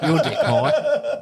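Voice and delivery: words squeezed out through high laughter; laughing. Real, not acted. Telling mates the story, breaking into laughter.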